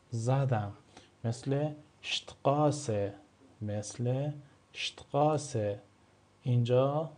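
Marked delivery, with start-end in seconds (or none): 1.2-3.1 Straße said شتقاسه, the S as sh before T, slowly; German St as sht. Shown as a demonstration. Teacher demonstrating the sht sound in شتقاسه. 4.7-5.8 شتقاسه with sh before the t, slow and careful; German St as sht. Shown as a demonstration. Teacher repeating the German word slowly for students.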